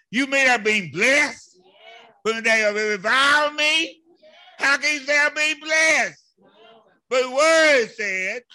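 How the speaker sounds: loud, high-pitched incredulous preacher; fired-up pulpit challenge to a congregation; full-force preaching. Acted. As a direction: preach it loud, throwing the verse out as questions. Preaches on verse just read.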